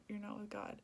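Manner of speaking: ordinary talk, nothing marked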